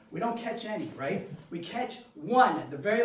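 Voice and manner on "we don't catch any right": ordinary delivery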